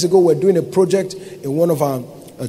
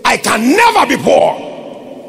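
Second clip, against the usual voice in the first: bold confession; 6 semitones higher, slower than usual. Acted. Confession quoted loud.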